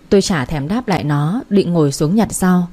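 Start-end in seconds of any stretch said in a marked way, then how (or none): none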